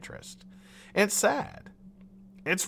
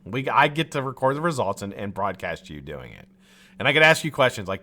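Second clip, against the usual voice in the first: ordinary talk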